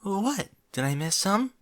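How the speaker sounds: uneasy Fluttershy. Acted. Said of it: "she became uneasy".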